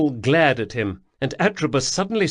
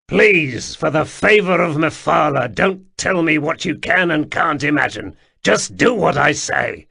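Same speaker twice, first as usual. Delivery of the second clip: louder, exasperated order; angry rebuke; 5 semitones higher, 6 dB louder than usual. Acted. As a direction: shut him down hard.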